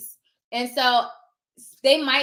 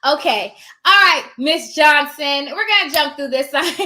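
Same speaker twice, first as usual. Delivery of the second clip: loud take-charge start; 3 semitones higher, 9 dB louder than usual. Acted. Notary starting a signing over a realtor, loudly. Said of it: "I'm gonna get started".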